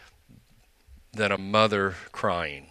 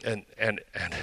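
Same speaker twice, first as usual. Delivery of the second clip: ordinary talk, nothing marked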